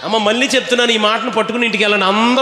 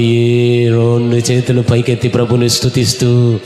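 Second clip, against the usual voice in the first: low, swelling preacher's proclamation; preaching exhortation; 6 semitones lower, slower than usual. Real, not acted. Preacher urging believers to raise hands in praise, intoned exhortation. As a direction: build in a low intoned voice to drawn-out hallelujahs.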